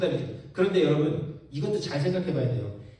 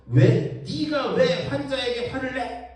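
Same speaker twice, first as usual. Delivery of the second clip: a higher, slowed mock complaint; retold patient abuse; 6 semitones higher, 4 dB louder, slower than usual. Patient's family berating the ER doctor, mock angry complaint. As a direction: hammer the doctor with mock blame. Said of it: "하는 욕은 항상 응급실 의사가 먹는 거야".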